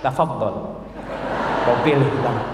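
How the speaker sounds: low, unruffled offer; calm wife. Acted. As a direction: offer it gently to a cross husband. Wife calmly answers husband's demand.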